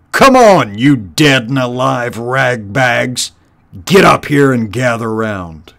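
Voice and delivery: angry commander rousing his rabble; shouted order. Acted. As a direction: holler impatiently at lagging troops. Warlord yells orders at his horde.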